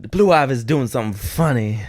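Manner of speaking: hammed-up Southern mama; biscuit-and-gravy impression. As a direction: report on the child in a drawly diva voice. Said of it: "my [name] impersonation".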